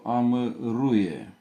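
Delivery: amăruie drawn out very slowly, syllable by syllable; spelled-out dictation pace. Shown as a demonstration. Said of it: "Avem de scris, de exemplu".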